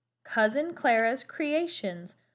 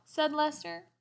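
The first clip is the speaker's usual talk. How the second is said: ordinary delivery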